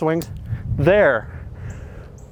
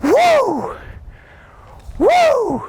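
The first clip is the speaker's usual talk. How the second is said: whoop. Real, not acted. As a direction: let out a big celebratory woo. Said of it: "Get excited".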